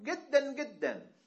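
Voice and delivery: گدن with a hard g sound in place of j, like an Egyptian sheikh. Retold or imitated. Teacher imitates Egyptian sheikh saying گدن with a g.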